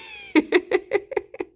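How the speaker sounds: very high squeal of laughter. Real, not acted. Streamer squealing with laughter at her own line.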